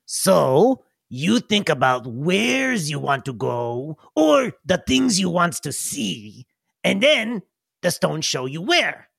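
slow, proud goblin voice. Acted. Goblin proudly explaining the portal potties, slow goblin voice. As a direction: explain it slowly, very pleased with himself. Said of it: "And he says".

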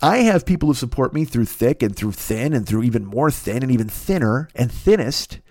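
normal talking voice

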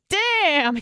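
high, loud, drawn-out mock shock; stunned exclamation. Reacting to a friend's discipline story, loud mock astonishment.

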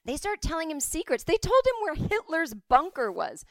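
high, loud excited telling. Real, not acted. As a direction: build up the thrill. Excited storytelling climax.

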